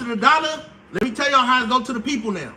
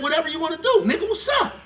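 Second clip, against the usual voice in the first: mocking China voice; 5 semitones higher, faster than usual.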